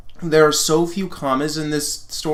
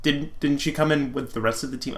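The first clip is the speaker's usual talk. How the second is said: ordinary delivery, nothing marked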